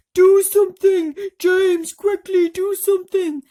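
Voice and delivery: high, very loud shout of a name; frantic shout. A narrator's reading. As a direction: scream his name in panic. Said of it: "cried the earthworm".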